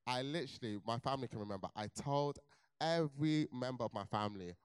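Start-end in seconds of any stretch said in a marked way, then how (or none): none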